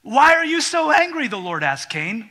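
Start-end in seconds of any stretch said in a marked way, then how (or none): 0.0-1.3 higher and louder, probing; a sharp divine question; God questioning. Acted. God asks Cain.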